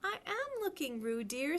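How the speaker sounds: a stern mother, quietly; rather severe. A narrator's reading. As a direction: scold gently but firmly. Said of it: "said Kanga rather severely".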